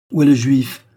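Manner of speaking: crié, plus aigu et plus fort; il exige une réponse, impatient. A narrator's reading. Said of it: "répéta-t-il avec impatience". (répété avec impatience, crié plus haut et plus fort)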